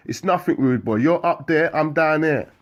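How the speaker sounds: high, loud taunt; shouting. Acted. Carlon shouting up at the rival, loud yell. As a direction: yell it up at him. Window argument between cells.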